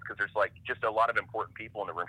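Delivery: ordinary talk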